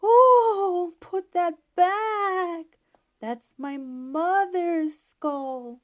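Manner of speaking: high, very slow wailing ghost pulling out every word; ghost's voice. A narrator's reading. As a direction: moan it like a hidden ghost, loud and drawn out. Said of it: "that old fellow hiding behind the door started in".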